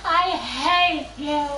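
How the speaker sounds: very slow, spaced-out mock hatred; drawn-out retort. Retold retort to a laughing friend, mock hatred drawn out. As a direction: stretch out each word of the retort.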